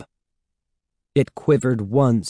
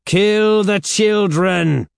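loud, high, drawn-out order; slow, ringing villainous command; repeated command; 11 semitones higher, 6 dB louder, slower than usual. A narrator's reading. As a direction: call the order out slowly, voice raised. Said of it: "Sarah Beth repeated".

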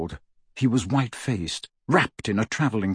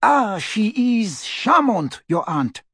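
high, slow, put-on cross voice; 10 semitones higher, slower than usual. A narrator's reading. Fleur to Bill about his aunt, cross. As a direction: draw it out crossly, pitched up. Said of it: "said Fleur crossly".

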